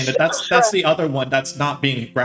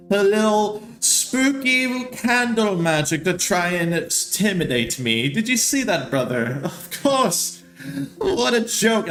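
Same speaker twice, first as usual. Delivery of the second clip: put-on high, sneering drunk noble; mocking drunk noble; 7 semitones higher than usual. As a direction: scoff at the candle trick.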